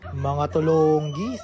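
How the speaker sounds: slow loud teasing jeer; mocking drawl. Real, not acted. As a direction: drag out the insult. Mocking the scared visitors. (mapanuyang pagbigkas)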